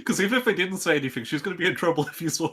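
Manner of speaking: high, loud, fast talk through laughter; laughing. Real, not acted. Player cracking up over a character seen in a car crash, laughing. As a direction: laugh helplessly while getting the point out.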